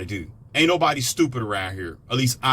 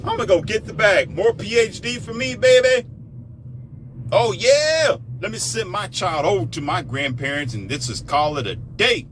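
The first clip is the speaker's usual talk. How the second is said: mocking mother; 7 semitones higher than usual. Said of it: "You're like".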